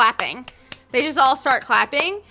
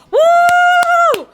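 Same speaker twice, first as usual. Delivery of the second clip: loud, much higher whooping cheer; excited cheer; 13 semitones higher, 10 dB louder, much slower than usual. Acted. Buntus cheers the crowd, a loud high whoop. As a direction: whoop it up like a hyped fan. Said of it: "Buntus just kinda looks at you guys and goes".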